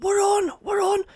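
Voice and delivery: high-pitched frustrated impression; strained, high imitation of panic on a call; Big Davey. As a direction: mimic a flustered caller, pitched right up. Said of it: "he kept getting frustrated".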